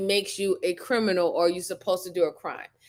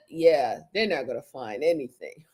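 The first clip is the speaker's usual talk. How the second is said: mocking the suspect; 8 semitones lower than usual. Mocking the suspect handing over his phone, low and smug. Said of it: "as if".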